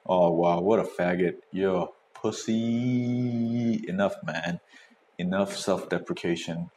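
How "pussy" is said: usual speaking voice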